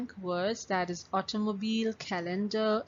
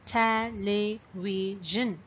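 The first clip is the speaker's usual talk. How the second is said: "Television" slowly, with a gap after each syllable; broken into syllables; much slower than usual. Shown as a demonstration. Teacher clapping out syllables for students, "Television" broken into parts. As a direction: say "Television" in four separate beats. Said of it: "you're breaking the word into parts".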